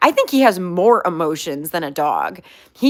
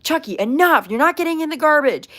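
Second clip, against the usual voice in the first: firm scolding; 7 semitones higher than usual. Retold or imitated. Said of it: "I eventually was like".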